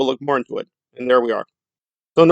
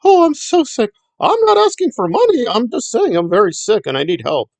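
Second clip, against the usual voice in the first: high whiny mock sick voice, much higher; hammed-up sickly whimper, high pitched; whining and crying; 11 semitones higher than usual. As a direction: whine it like a fake invalid begging. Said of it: "she's whining and crying".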